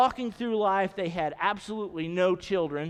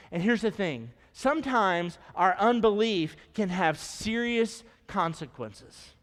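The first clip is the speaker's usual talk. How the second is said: ordinary delivery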